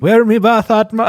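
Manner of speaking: loud, high mock Jamaican voice; hammed-up Jamaican voice that comes out like a pirate; mock Jamaican accent. Friend redoing his Jamaican bit, loud and high, pirate-like. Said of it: "I sound like a fucking pirate".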